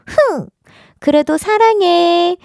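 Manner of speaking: high pouty humph, then slow; hammed-up aegyo pout; cute pout then sweet. Pouting then affectionate read.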